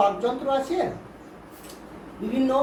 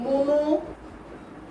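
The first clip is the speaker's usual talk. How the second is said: "মনোসিলেবেল" drawn out in two parts, মনো then syllable; slow dictation; 3 semitones higher, much slower than usual. Shown as a demonstration. Teacher dictates a heading to pupils writing, slowly broken up. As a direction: dictate "মনোসিলেবেল" very slowly, pausing mid-word.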